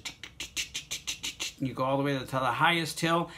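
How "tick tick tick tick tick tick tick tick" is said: unvoiced tick tick tick like a coaster climbing; soft roller coaster ticking; whispered ticking. Shown as a demonstration. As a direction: whisper the clicks of the chain lift. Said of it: "on a roller coaster".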